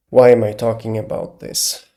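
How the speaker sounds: ordinary delivery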